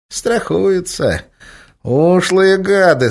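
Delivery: slow, high, sneering cat; drawn-out snide tomcat. A narrator's reading. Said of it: "сказал кот".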